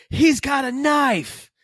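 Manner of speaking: high, loud shout of alarm; retold yell. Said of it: "Who yelled".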